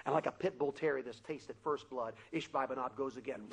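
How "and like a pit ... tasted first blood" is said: ordinary talk, nothing marked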